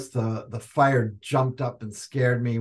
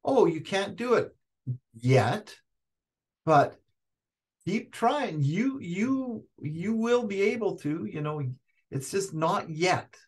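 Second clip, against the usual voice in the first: higher-pitched, gentle encouragement; encouraging teacher; 7 semitones higher than usual. Shown as a demonstration. Modelling what to say instead of try harder, lifted encouraging tone. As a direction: coax a struggling learner along, voice lifted. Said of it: "why not say".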